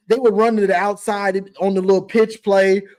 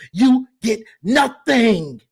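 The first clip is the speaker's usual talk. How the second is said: slow taunt; slower than usual. Pretend. Taunting Ohio State.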